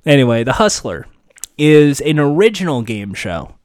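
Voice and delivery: usual speaking voice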